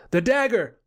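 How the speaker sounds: sudden high call for the weapon; urgent cry. A narrator's reading. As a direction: snap the warning out high. Ranger springs to life.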